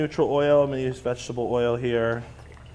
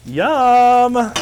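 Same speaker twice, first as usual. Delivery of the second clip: delighted; 10 semitones higher, 8 dB louder than usual. Real, not acted. Admiring the roasted eggplant.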